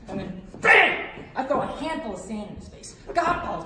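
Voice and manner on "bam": loud explosive bam; sudden impact shout. Retold or imitated. Comic springing his attack on God, explosive shout. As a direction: hit it like a surprise blow. Sudden strike after hushed waiting.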